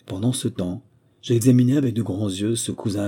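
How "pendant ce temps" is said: ordinary delivery, nothing marked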